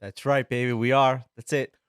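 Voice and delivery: ordinary talk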